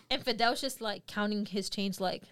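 normal talking voice

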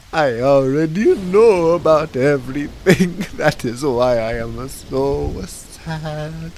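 loud, slow, mournful clown voice; Mister Panini. Acted. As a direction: wail it like a tragic clown. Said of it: "I was Mister Panini".

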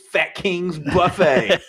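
giggling quip; laughing. Real, not acted. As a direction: savour the joke through a laugh. Joking with a laugh.

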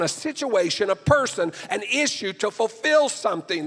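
loud, high, fervent preaching at full force; frustrated preaching. Real, not acted. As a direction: pour your frustration into the sermon, loud and high. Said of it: "I sound frustrated in my voice".

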